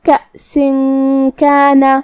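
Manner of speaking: کأسن with the nun hidden in a nasal hum before کانا; ikhfa demo. Shown as a demonstration. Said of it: "تو ہم یہ حکم کو ایسے ادا کریں گے". (کأسن کانا میں نون کو غنہ کے ساتھ چھپا کر)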